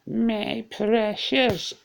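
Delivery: Golem. Acted. Player imitating the attacker coming for him, drawn-out high creature impression. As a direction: stretch it out slowly in a high, put-on voice. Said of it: "incredibly bad Ukrainian accent".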